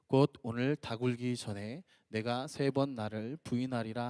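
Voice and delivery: slow, low, solemn recitation; scripture reading. Acted. Pastor reading Peter's denial with the congregation, slow and solemn. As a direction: read the passage out unhurriedly in measured phrases.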